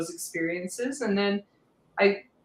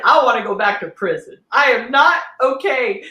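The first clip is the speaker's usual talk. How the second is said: mock despair; 4 semitones higher, 10 dB louder than usual. Retold despair at halfway house.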